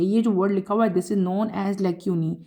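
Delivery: ordinary talk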